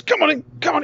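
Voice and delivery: high, loud goading; loud boxer's come-on; squaring up. Retold or imitated. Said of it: "puts his fists up in a boxer style".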